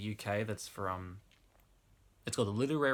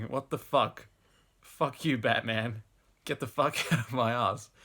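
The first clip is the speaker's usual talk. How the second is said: raised-voice narrated protest, short of a scream; mild mock scream; 3 semitones higher, 9 dB louder, slower than usual. A narrator's reading. Victim yelling at Batman in the story, mild mock scream. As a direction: protest loudly while still reading aloud. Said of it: "I'm screaming".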